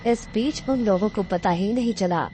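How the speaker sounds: ordinary delivery, nothing marked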